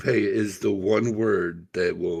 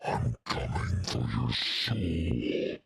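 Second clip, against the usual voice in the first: demonic whisper; 9 semitones lower, 7 dB quieter, much slower than usual. Pretend.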